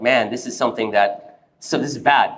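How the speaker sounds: low, subdued, dejected mumbling to himself; despondent. Retold or imitated. Husband walking back home after the scholars, despondent. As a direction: voice sunk low, as if head hung. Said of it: "despondent, depressed, with his head down".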